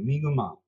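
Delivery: Mi'kmaq in three syllables, "mee-guh-maw". Shown as a demonstration. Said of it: "There's actually three".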